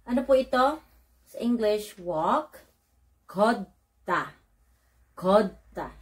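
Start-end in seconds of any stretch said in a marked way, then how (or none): none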